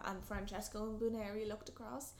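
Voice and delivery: ordinary delivery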